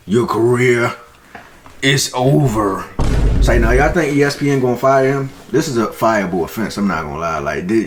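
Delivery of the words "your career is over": measured words with a long pause before "is over"; grave verdict. Real, not acted. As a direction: deliver it like a sentence being passed. Pronouncing a grave verdict on Shannon.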